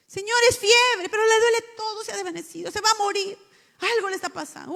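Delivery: high loud mock panic; overreacting about a fever; frantic mother. (madre alarmada gritando por la fiebre)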